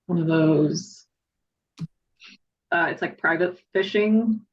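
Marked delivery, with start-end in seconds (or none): none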